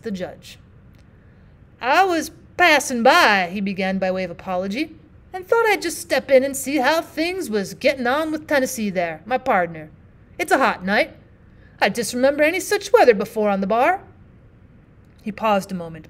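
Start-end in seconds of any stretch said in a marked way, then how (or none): 1.8-3.5 a hint of a slow, loud old miner; lightly voiced plain-spoken miner, apologetic; rough miner partner. Partner begins his apology. 5.3-14.0 lightly voiced plain-spoken miner, apologetic; rough miner partner. Partner speaking to the judge.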